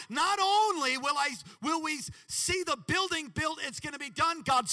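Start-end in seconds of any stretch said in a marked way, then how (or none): none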